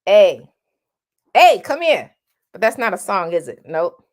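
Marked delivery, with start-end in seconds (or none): 0.1-2.2 loud high "A, come here" with a spread vowel; wrong A. Shown as a demonstration. Coach mocking the wrong A sound. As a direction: call "A" brightly like a name.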